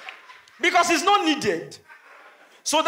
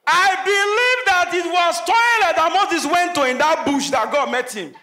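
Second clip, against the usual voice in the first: higher, louder and slow, punching the joke; big comic reveal; loud comic punchline; 5 semitones higher, 6 dB louder than usual. Real, not acted. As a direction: declare it like a preacher's revelation.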